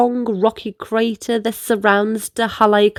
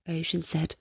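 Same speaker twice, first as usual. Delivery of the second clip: ordinary delivery, nothing marked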